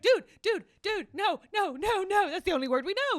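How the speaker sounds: high, loud frantic dude-no; panicked. Retold or imitated. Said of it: "I really panicked".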